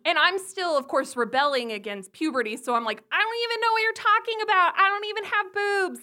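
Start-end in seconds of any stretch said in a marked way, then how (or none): 3.1-6.0 high, loud, fast whine; flustered teen protest. Retold or imitated. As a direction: protest in hammed-up adolescent distress.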